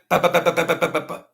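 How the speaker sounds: rapid mock gunfire sounds; imitated gunshots. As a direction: rattle off the shots.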